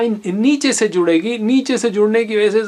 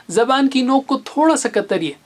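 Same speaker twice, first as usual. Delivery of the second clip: normal talking voice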